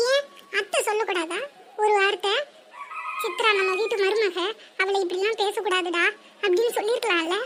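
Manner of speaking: alone, niece mutters bitterly about her aunt's silence; muttered grievance. Acted. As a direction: mutter the complaint so nobody hears. Resentful muttering to herself alone.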